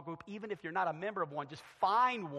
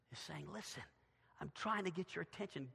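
soft, confidential voice; hushed appeal; 10 dB quieter than usual. Acted. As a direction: quietly coax them to listen. Voices the Spirit gently.

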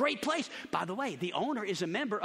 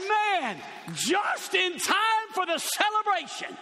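excited shout; 9 semitones higher, much slower than usual. Retold or imitated. Preacher telling Shane the good news, slow joyful shout. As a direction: stretch it out in happy triumph. Retelling his reply to Shane.

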